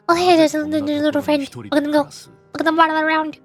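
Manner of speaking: ordinary delivery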